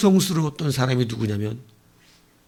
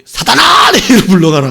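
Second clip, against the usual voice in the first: much higher, louder anguished cry; crying-out rebuke; 6 semitones higher, 10 dB louder than usual. Acted. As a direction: hurl the worst insult at him. Pastor rebukes loyal deacon.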